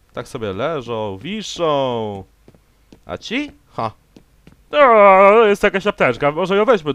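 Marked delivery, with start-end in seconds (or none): 4.7-6.9 high, loud and bright, pointing out the medkit; eager teammate voice. Acted. Gamer voicing a teammate who spots a medkit, excited. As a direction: play the keen squadmate, pitched up and loud.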